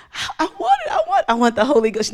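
high, stammered begging; squeaky plea dropping into a hurried demand; mock tearful plea. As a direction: imitate an overcome woman pleading. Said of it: "She's crying".

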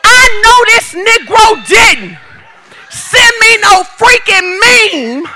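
slow mock-outraged texting voice; indignant girlfriend quote. Wife venting to her girlfriend, slow and outraged. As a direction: drag it out, scandalized. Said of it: "send it to your girl and say".

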